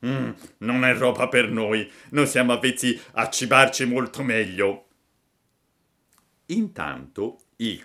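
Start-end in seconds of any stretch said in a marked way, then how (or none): 0.1-4.9 lightly voiced fussy fish; disdainful fish. A narrator's reading. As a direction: turn down the food with mild disdain. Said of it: "pareva volesse dire". (pesce schizzinoso)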